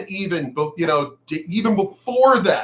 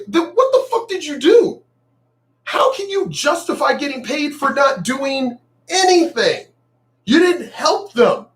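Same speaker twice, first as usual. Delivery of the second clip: higher, heated retold demand; mock-indignant outburst; retold angry challenge; 9 semitones higher than usual. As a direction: confront her the way he did back then. Retold confrontation with psychiatrist girlfriend.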